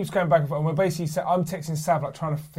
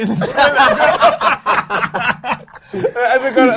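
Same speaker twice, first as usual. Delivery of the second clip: laughing; 7 semitones higher, 8 dB louder than usual. Real, not acted. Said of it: "It's disgusting".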